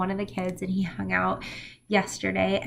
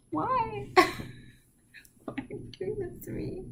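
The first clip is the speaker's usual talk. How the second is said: high, slow, half-whispered whimper; mock tearful plea; 11 semitones higher, 4 dB quieter, much slower than usual. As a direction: play a teary mom pleading with her crying kid. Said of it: "And I'm like".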